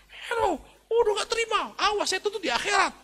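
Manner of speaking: very high, shrieking threat; mock outraged woman. Voicing furious offended woman.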